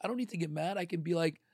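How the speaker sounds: ordinary talk, nothing marked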